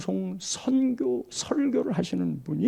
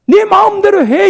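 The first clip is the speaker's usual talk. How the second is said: higher and louder, fed-up snap; exasperated mother; 11 semitones higher, 13 dB louder than usual. Retold or imitated. Mother gives up on her stubborn child, shouting. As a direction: throw up your hands at the child.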